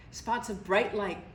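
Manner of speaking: ordinary delivery, nothing marked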